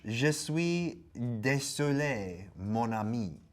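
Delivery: slow, drawn-out French apology; theatrical French read-out. Acted. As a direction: say it slowly with a French accent.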